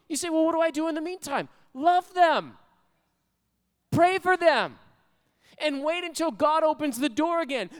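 high, urgent preacher's exhortation; fervent preaching. Acted. Preacher exhorts with rising fervour.

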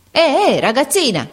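put-on sharp, commanding call to the girl; imperious call. A narrator's reading. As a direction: summon her like a haughty servant. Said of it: "disse imperiosamente". (chiamata imperiosa)

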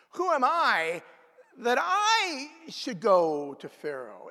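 high, slowed protest of Moses. Acted. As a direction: plead it as Moses refusing. Voicing Moses.

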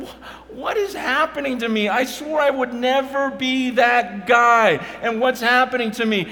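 loud, high anguished cry; retold wailing. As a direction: cry out to God, distraught. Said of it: "wailing before the Lord, just going".

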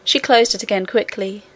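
usual speaking voice

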